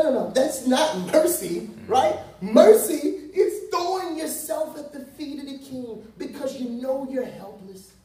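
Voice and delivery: normal talking voice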